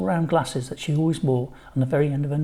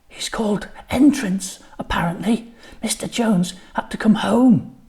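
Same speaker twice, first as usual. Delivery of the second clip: put-on gossiping kid; 6 semitones higher than usual. A narrator's reading. Kids gossiping at lunch.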